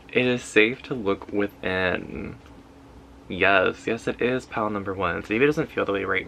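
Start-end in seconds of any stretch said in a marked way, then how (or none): 0.1-2.4 slow, deliberate reading at normal pitch; slow affirmation. Real, not acted. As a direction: let each word settle slowly. Affirmation repeated slowly for effect.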